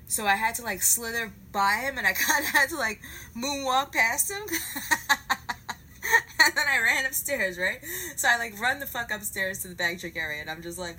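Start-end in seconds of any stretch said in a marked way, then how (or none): 4.5-6.4 loud cackling laugh. Real, not acted. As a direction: crack up at your own joke. Laughing at her own moonwalk joke.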